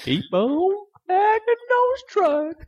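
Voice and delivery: squeaky, stretched sign-off; very high slow mock voice. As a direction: squeak it out slowly. Echoes sign-off in voice.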